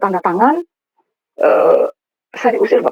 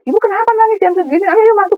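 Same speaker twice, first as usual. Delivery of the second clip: much higher-pitched quoted concern; imitated beach guard; 11 semitones higher than usual. Beach guard finds woman crying at night, high-pitched concern. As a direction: voice the guard calling her inside, pitched right up.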